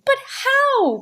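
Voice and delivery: a hint of a small boy; curious child. A narrator's reading. Michael questioning the uncle, high childlike.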